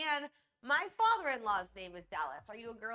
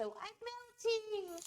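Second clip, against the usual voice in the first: high, slow drawn-out wail; hammed-up melting villain; stretched, squeaky lament; mock melting witch; 13 semitones higher, much slower than usual. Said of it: "turns into".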